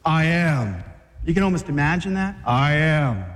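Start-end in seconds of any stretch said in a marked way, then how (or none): 0.0-0.8 swagger. Acted. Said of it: "He had the man swagger too". 2.5-3.2 swagger. Acted. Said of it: "He had the man swagger too".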